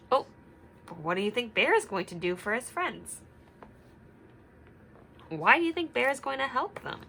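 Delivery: ordinary talk, nothing marked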